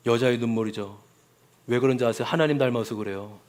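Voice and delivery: usual speaking voice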